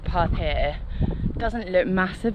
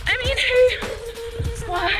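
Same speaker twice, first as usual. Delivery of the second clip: stretched high "who"; slow, high, disbelieving; drawn-out high exclamation; 14 semitones higher, much slower than usual. Real, not acted. Exasperated at own choice.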